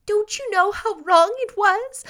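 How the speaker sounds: high-pitched, tearful question; quivering reproach. A narrator's reading. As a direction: scold while close to crying. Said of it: "in a quiver in her voice".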